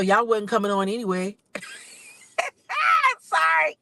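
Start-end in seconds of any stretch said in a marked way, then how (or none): 2.4-3.2 high shrieking laugh; loud burst of laughter. Real, not acted. Laughs at own joke. 3.2-3.8 laughing apology. Real, not acted.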